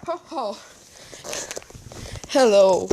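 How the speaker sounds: booming ho-ho then a big hello; a lightly voiced jolly Santa greeting. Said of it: "Santa is just like".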